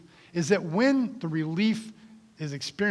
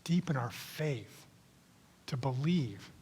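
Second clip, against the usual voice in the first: hushed prayer; 4 semitones lower, 10 dB quieter than usual. Acted. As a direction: pray quietly over the congregation.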